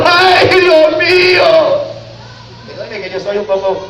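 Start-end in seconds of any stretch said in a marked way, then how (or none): 0.0-1.4 high, drawn-out hammed-up wail; mock wailing cry. Drug-selling mother crying over her sons, hammed-up wail. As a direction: wail it like a mother in despair, overdone on purpose. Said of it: "me gusta meterle drama al asunto".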